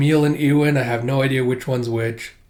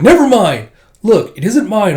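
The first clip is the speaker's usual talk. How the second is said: louder, higher protest; flustered outburst; 5 semitones higher, 8 dB louder than usual. Retold or imitated. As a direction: snap it out defensively. Flustered denial to lackeys.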